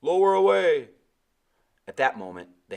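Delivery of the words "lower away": high, loud call; yelled order. A narrator's reading. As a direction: yell it across the deck. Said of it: "someone yelled".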